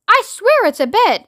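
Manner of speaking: high-pitched, earnest protest; insistent. A narrator's reading. Shanks protesting to the President, insistent. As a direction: swear it to be believed.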